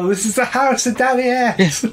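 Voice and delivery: high, put-on Daddy Ass accent; imitating Daddy Ass. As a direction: ham up his accent. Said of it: "It's the way he says it".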